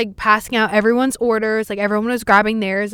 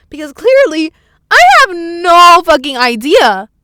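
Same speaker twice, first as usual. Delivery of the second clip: loud, high, drawn-out emphasis; slow, high exasperated shout; exasperated outburst; 7 semitones higher, 11 dB louder than usual. Real, not acted. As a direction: yell it in exasperation. Venting about the cashier.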